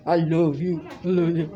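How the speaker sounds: low, droning imitation of mumbled begging; mumbling robotic beast. Imitating someone outside the room, low robotic mumble. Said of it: "like mumbling, like a robot".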